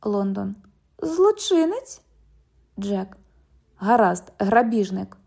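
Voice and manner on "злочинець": high, slow incredulous question; can't believe that word. Acted. London questions the cop's word, slow and incredulous.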